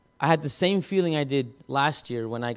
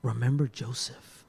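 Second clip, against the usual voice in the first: slow, soft, calm inner voice; hushed, reassuring voice breaking the silence; calm masculine voice; 6 dB quieter than usual. Retold or imitated. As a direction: say it quietly and slowly, like a voice from above. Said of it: "very masculine but calm voice".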